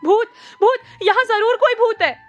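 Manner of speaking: very high, loud frightened shriek; frightened screaming about a ghost; scared scream. A narrator's reading. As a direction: scream in terror. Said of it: "डर के मारे चिल्लाई".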